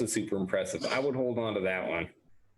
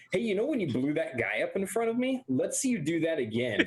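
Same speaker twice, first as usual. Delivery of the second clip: eager teacher in character; 7 semitones higher than usual. Acted. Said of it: "the first thing he says to her".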